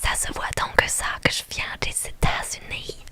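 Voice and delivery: put-on whispered American-accented French; hammed-up American accent in French. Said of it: "Un accent américain". (accent américain exagéré)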